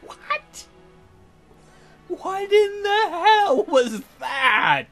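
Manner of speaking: ordinary delivery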